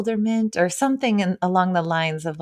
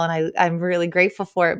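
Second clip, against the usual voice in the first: ordinary talk, nothing marked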